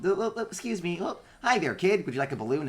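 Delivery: high cheery robot chirp; runner bot voice. Acted. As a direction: offer the balloon like a bubbly little bot.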